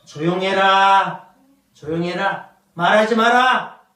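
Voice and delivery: slow, loud, authoritative order; commanding silence. Acted. God silencing the angry Israelites, stern command.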